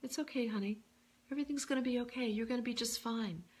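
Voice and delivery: lightly comforting, like a wise elder; Louise Hay's gentle voice. Retold or imitated. Mentor reassuring herself and the speaker, lightly softened. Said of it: "I imagine her voice, you know, she would say".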